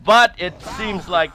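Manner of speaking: loud, high hype call; excited play-by-play. Real, not acted. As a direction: call the chase with rising hype. Teamfight play-by-play.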